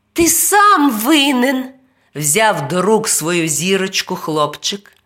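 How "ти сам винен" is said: put-on accusing boy. A narrator's reading. Boy blames the imp.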